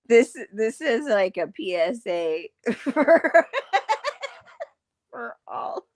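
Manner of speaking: words broken by laughter; laughing. Real, not acted. Laughing while talking.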